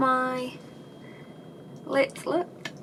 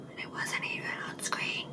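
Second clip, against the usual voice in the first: breathy whispered grumble under breath; whispered aside; 8 dB quieter than usual. Real, not acted. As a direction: mutter the complaint in a whisper. Grumbling aside about wasted filming.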